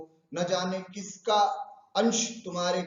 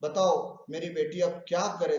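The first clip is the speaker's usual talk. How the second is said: usual speaking voice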